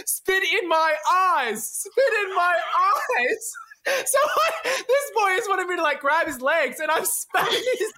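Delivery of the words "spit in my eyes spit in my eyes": high, slow, stretched-out repeat; misheard phrase. Retold or imitated. Partner explaining what they misheard, slow high-pitched repeat. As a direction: draw out each word to show the mishearing. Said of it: "And I heard".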